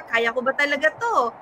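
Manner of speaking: usual speaking voice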